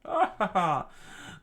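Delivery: high, loud evil cackle; villain cackle. Acted. Sir Crocodile cackling over his fallen foes. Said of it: "Sir Crocodile scream out".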